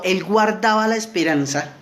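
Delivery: usual speaking voice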